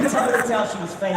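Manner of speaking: normal talking voice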